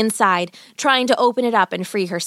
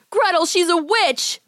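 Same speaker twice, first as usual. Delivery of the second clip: high, drawn-out cry of alarm; alarmed boy; 9 semitones higher, slower than usual. Acted. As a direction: warn your sister in horror. Boy realizes she's a witch.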